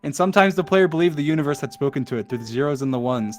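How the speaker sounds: soft, low, hushed reading; solemn poem reading. Acted. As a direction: let the ending sink in gently. Reads poem's ending.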